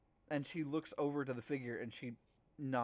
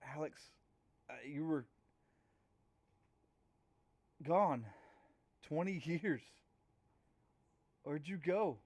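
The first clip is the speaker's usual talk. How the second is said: lightly put-on Russian accent, halting. Long-lost friend greeting Alex in a faint Russian accent. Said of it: "You hear a Russian accent".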